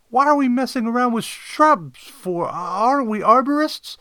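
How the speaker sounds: high, loud puzzled sidekick; assistant Kodet. Acted. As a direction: question the boss in a high voice. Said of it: "Kodet wondered".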